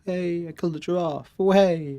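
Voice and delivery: mock hunter boast. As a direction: brag like a hunter posing with his kill. Mock quote of hunter posing.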